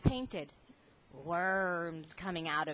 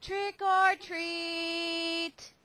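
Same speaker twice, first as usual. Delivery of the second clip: slow, drawn-out, a bit louder and higher; a little louder; 8 semitones higher, much slower than usual. Acted. Lance tries again at the lady's house, slow and a little louder. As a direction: stretch it out louder. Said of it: "He said it a little louder".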